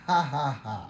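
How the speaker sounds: declared ha-ha-ha; laughing at illness. Shown as a demonstration. Preacher laughing at cancer by faith, deliberate laugh. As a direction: laugh on purpose at the disease. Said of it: "Tawanan ninyo".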